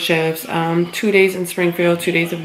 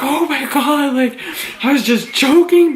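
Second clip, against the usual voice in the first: high, loud mock protest; alarmed backtracking; 7 semitones higher, 4 dB louder than usual. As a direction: re-enact a flustered just-kidding plea. Hasty backtracking after supervisor's gasp.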